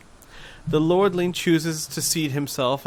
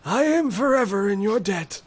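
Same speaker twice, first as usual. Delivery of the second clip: put-on frail old man; 9 semitones higher than usual. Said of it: "says the frail old man".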